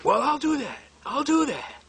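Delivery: imitating a high, eager yes; eager agreement. Client jumping at the chance to countersue, eagerly.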